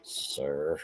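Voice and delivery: low, dropped, very soft "Sir."; offended at being called sir, muttered under the breath; deadpan sir. Retold or imitated. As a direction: let the word fall flat and quiet in disbelief. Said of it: "I'm like".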